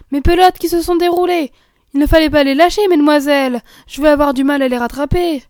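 put-on loud, exasperated old keeper; scolding gamekeeper. Gamekeeper scolding the young ladies, loud and exasperated. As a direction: grumble loudly while chasing.

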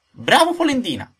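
high, loud mocking little voice; tiny creature piping up loudly; little voice crying out. A narrator's reading. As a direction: cry out in a small, high voice. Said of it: "gridò la solita vocina".

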